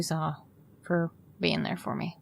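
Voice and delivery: quiet, tender thank-you to a friend; soft grateful thanks. Acted.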